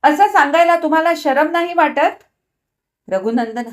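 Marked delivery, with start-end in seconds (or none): 0.0-2.2 high, loud shriek. A narrator's reading. Wife shrieks at husband over his confession, high and loud. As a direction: shriek it at him. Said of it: "तिने किंचाळून म्हटले".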